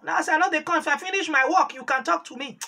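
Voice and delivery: calling loudly down the line; loud retold shout. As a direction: shout it down the phone. Retold shout over phone.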